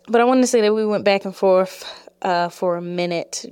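ordinary delivery

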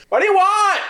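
lady rider, high and pointed; high put-on rider voice. GM voicing the lady on the dragon, high and wary. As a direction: pitch it way up, wary and curt.

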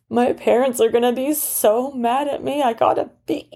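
put-on a worried boy close to tears; sniffling boy. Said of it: "the boy sniffled and said".

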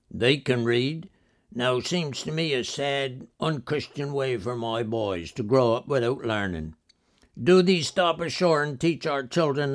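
put-on old West Country fisherman's accent. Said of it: "in his old country accent said".

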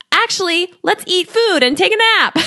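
high-pitched pretend sales line; mock chirpy pitch. Coach mimicking her own offer against weight-loss ads, mock chirpy. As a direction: pitch it high, sending yourself up.

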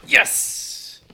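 loud burst of relief; triumphant yes. Real, not acted. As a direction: celebrate a last-second right answer. Gets the answer just in time.

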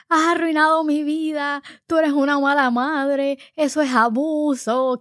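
high, loud, drawn-out tantrum cries; hammed-up daughter's outburst; mock screaming. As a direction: stretch each accusation like a wailing child. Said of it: "Y ella gritando".

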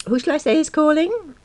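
high, polite telephone manner. A narrator's reading. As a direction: ask politely in the receptionist voice. Receptionist asks caller.

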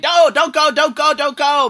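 high, loud imitated begging; mock pleading. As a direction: beg them to stay, hammed-up. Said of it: "The parents were pleading to me".

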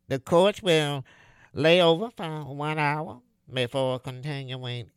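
nasal bus driver over the speaker; pinched-nose voice. Acted. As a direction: announce stops through a pinched nose. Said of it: "like a clothespin pinched his nose".

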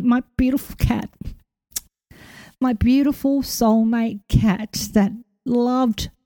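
fighting back tears over the cat; emotional. Real, not acted. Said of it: "I'll try and just keep the emotion".

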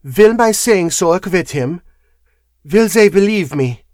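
put-on contained eagerness in a foreign accent; suppressed eagerness. A narrator's reading. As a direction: press for an answer, holding back. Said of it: "suppressed eagerness in her manner".

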